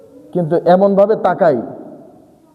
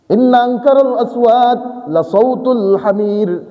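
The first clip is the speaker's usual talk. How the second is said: slow chanted verse; Quran recitation; 6 semitones higher, slower than usual. Acted. Preacher reciting the verse in the middle of the sermon. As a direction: recite it with a slow melodic line.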